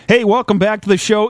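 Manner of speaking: ordinary talk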